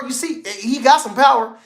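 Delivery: ordinary delivery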